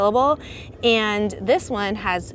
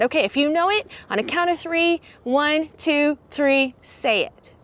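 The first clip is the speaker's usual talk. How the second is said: teacher counting; 5 semitones higher than usual. Retold or imitated. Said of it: "I said".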